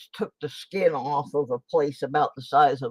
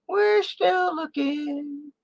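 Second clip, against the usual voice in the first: much higher, slow and stretched; high drawn-out singsong; much slower than usual. Pretend. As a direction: sing it out slowly up high. Repeats phrase in put-on voice.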